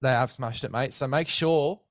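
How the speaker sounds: upbeat sponsor pitch to a mate; hyped ad read. Acted. Host pitching sponsor gear to guest, hyped ad read. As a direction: sell the gear like a mate on air. Sponsor code plug continues.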